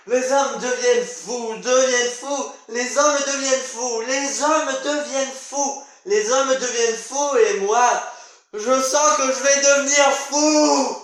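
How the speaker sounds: drawn-out frantic declaiming, rising to a cry; mounting madness. Acted. Character sensing he is going mad, frantic and building.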